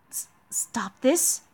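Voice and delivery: soft, slow stammered question; taken aback. Acted. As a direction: echo the rebuke in quiet disbelief. Scolded knight echoes it in disbelief.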